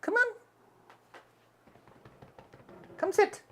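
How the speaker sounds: very high coaxing call; pet talk. Real, not acted. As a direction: coax the cat up high. Calling the cat.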